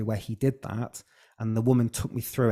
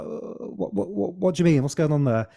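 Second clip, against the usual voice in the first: higher, startled "what, what, what"; retold surprise; 6 semitones higher than usual. Guest replaying his shocked reply to his mum, higher and startled. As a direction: stammer it out, caught off guard. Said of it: "I was like".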